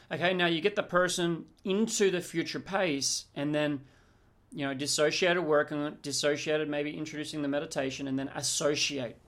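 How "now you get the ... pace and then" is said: ordinary delivery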